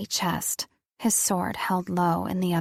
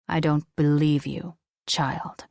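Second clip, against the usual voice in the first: solemn deep voice of an angel; 3 semitones lower than usual. Acted. Angel doubts her.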